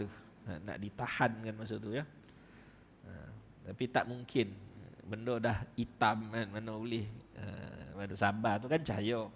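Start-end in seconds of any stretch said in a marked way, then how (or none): none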